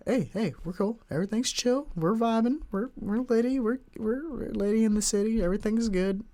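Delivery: high, light placating voice; mock reassurance. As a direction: talk a panicked mom down, keeping it breezy and high. Calming his alarmed mom, retold.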